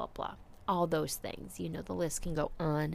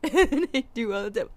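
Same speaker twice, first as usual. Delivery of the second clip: laughing through the words; giggly, loud and quick; 5 semitones higher, 10 dB louder, faster than usual. Real, not acted. Laughs while speaking.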